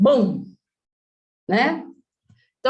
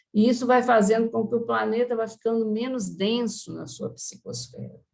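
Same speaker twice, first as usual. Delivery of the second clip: ordinary delivery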